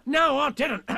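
loud, high, indignant denial; Tigger's protest. A narrator's reading. Tigger cuts off Rabbit, loud and indignant. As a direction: cut him off, protesting.

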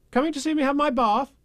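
put-on high, quick little boy; young boy voice. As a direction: chirp it like a small boy. Child voice calling at door.

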